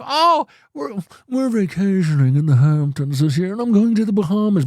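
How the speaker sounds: high, mocking voice of a show-off influencer; lightly lampoon a smug social media star; a hammed-up humblebrag. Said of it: "these influencers you see on social media".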